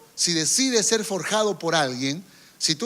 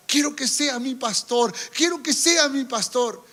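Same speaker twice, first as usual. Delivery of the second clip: higher pleading voice of a tearful man; mimicked crying plea; 7 semitones higher than usual. Man weeping to his pastor, pleading higher. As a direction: beg like a weeping church member. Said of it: "seguía llorando diciendo".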